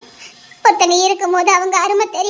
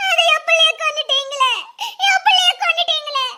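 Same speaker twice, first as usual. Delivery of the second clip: sobbing accusation; 5 semitones higher than usual. Acted. Mother accuses family of killing daughter.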